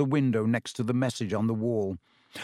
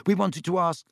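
hasty, higher explanation; quick; 6 semitones higher than usual. A narrator's reading. Harry hurries to explain to Myrtle, quick. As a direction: get it in quickly. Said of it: "said Harry quickly".

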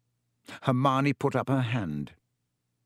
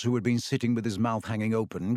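ordinary delivery, nothing marked

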